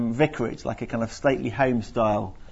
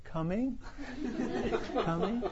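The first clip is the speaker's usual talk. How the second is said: echoing; 9 semitones higher, 11 dB quieter than usual. Retold or imitated. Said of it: "his voice would kind of reverberate".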